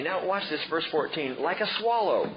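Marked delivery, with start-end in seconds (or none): 1.4-2.3 mock lament. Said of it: "twittering and moaning".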